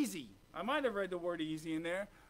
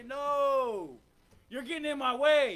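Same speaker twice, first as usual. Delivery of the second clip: loud, firm divine no; stern rebuke; 4 semitones higher, 9 dB louder, slower than usual. Acted. God stopping the cocky preacher, loud and firm. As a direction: shout him down as God.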